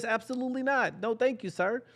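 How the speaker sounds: high, dismissive no thanks; sassy refusal. Acted. Comedian refusing the overzealous kid, mock prim. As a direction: wave it off primly.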